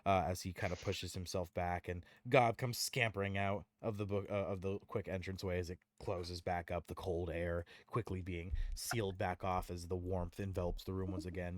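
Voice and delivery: usual speaking voice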